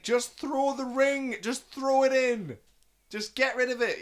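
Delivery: high, loud, drawn-out plea; acted-out exasperated shout; desperate urging. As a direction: beg him loudly to do it.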